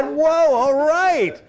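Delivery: a joyful Abraham giving the tenth away freely. Retold or imitated. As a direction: toss it off like a happy gift. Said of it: "He just said".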